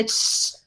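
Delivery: hushing shh, unvoiced; shushing. Shown as a demonstration. Teacher showing the sh sound by hushing. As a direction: shush someone into silence. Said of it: "uno sí calla a la gente así".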